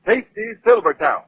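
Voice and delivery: ordinary talk, nothing marked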